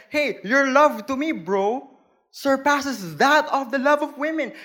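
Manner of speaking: David gushing to his bro, mildly hammed; a touch of a casual guy talking to his best friend. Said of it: "here comes David telling his brother".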